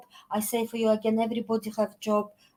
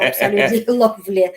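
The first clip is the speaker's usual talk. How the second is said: loud, quick, laughing; laughing praise; 8 dB louder, faster than usual. Real, not acted. Guest delightedly praising the host's programme, laughing. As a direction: laugh through the words.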